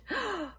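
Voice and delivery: high, sudden gasp of shock; sharp gasp. Acted. Maria seeing the ring, sharp gasp. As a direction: gasp in surprise. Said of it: "she gasped".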